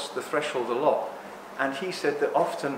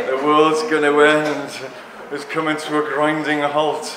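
slow, louder, hammed-up doom warning; mock doom; 6 dB louder than usual. Speaker parodies his serious lecture style, hammed-up doom. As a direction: announce the apocalypse with a wink. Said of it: "I can be here and be really serious if you want".

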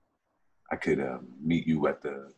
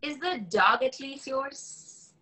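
high, slow questioning woman's voice; young woman's voice; 5 dB quieter, slower than usual. Acted. Woman quizzing her date about the dog, high and slow. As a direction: ask it as the wary young woman, pitched up.

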